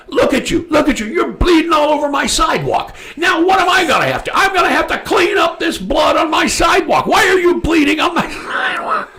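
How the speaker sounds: high, hammed-up outraged shouting; high-pitched mock tirade; retold yelling. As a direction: imitate an indignant man berating someone at full pitch. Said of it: "yelling at him".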